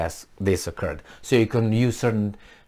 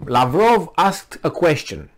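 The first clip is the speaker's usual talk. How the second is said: ordinary talk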